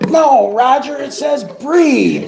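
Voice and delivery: high, loud, slow correction on breathe; surprised, stretch out breathe; surprised Vicki. Retold or imitated. Vicki correcting Roger's misreading, high surprised and drawn out. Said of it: "when she gets kind of surprised".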